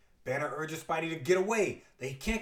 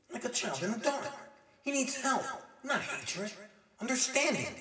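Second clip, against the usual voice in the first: sympathetic hero; 6 semitones higher than usual. Acted. Spidey pities the departing Hulk.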